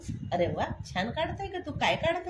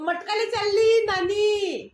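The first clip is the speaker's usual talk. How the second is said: words through real laughter, slow and higher; laughing; 7 semitones higher, 5 dB louder, slower than usual. Real, not acted. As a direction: laugh the line out. Mother laughing at the answer.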